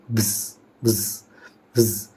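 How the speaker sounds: भिस as a short, voiced "vz" ending; plural ending sound. Shown as a demonstration. Teacher drills the plural ending, sound isolated. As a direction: say each भिस alone as a brief buzzing "vz". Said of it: "इसमें क्या पढ़ेंगे?".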